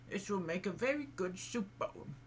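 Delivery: high, lightly voiced old woman.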